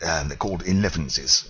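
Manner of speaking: plain reading voice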